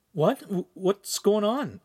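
high, slow, confused stammer; startled awake. A narrator's reading. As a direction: stumble into a high-pitched question. Shaken awake from sleep.

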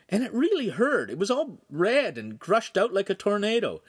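high, plaintive grumble; whining. A narrator's reading. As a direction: complain of pain in a high whine. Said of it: "he whined".

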